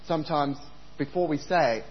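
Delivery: ordinary talk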